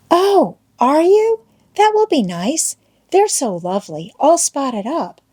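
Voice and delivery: put-on high, wide-eyed little-girl delight; admiring schoolgirl Becky. A narrator's reading. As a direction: swoon sweetly over the idea. Girl admires clown plan.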